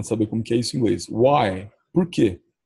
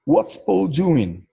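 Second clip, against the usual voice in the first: slow model sentence; 7 semitones higher, much slower than usual. Shown as a demonstration. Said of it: "Vamos falar a frase inteira".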